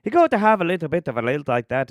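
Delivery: quick, high, lilting fake Irish; bad Irish accent. Shown as a demonstration. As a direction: put on a terrible Irish lilt. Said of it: "the bad Irish is".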